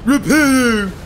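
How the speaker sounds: high, loud, silly wizard cry; mock someone grandly waving a wand; mocking spell-caster. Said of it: "You're just waving your wand going".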